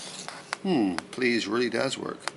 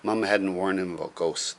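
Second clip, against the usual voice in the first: ordinary delivery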